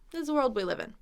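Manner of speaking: quick, run-together sentence; snapped through. Shown as a demonstration. Teacher shows the quick version, snapped through. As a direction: snap right through the words. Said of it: "you can snap right through it".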